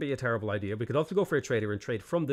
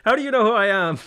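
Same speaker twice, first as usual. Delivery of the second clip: louder, higher, laughing disbelief; mock surprise; 9 semitones higher, 10 dB louder than usual. Streamer retelling his shock at Turkish fans, loud laughing surprise. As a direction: act shocked at being recognized, through laughter. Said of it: "I'm just like".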